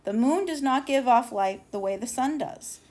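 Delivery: plain reading voice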